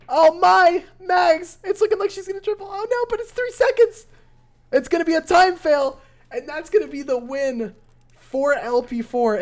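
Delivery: high, loud, rushing caster shouting the finish; real thrill as the clock runs out; excited climax call. Real, not acted. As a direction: caster shouts the deciding moment to the stream. Caster calls the match-deciding time fail.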